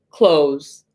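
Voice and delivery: ordinary talk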